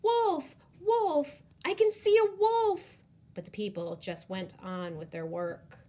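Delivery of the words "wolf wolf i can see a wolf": high, loud desperate yell; boy shouting down to the village, panicked. Acted. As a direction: scream for help for real. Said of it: "He shouted down to the village".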